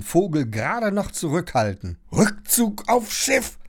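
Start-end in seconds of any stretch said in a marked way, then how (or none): 2.1-3.6 high, slow snarled command; sharp, high-pitched snapping; hissed order. A narrator's reading. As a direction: spit out the order at the bird. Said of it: "faucht sie ihn an".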